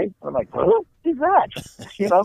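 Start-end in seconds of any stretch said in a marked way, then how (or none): 0.5-1.5 high, incredulous who is that; startled disbelief. Retold or imitated. Husband startled when his wife agrees to go. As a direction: caught off guard by his own wife's answer. Shocked at wife saying yes.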